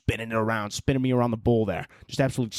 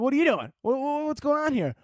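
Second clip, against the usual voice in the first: much higher, puzzled puppy voice; confused dog voice; 12 semitones higher than usual. Retold or imitated. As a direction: voice the puppy baffled by you. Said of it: "he's like".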